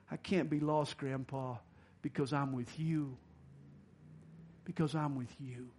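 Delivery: hushed sincere reply; tender child reply. Retold or imitated. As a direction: let the boy's answer fall soft and sure. Boy's tender reply in story.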